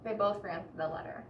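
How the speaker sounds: usual speaking voice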